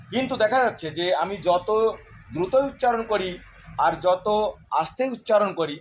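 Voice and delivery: usual speaking voice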